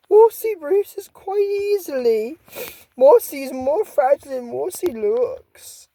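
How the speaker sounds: high, slow, fragile monster voice; timid swamp monster explains herself, high and slow; timid creature voice. Acted. Mossy speaking in third person.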